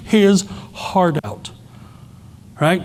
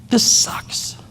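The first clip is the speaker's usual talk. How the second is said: a child's unvoiced whisper; soft whispered complaint; 4 dB quieter than usual. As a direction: breathe it out sadly like a little girl. Daughter on dad's lap.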